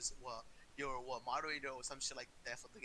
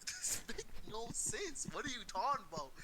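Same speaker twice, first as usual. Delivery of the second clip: exasperated disbelief; 6 semitones higher, 3 dB louder than usual. Real, not acted. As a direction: stretch it out high, like you can't believe it. Exasperated at his friend's complaint.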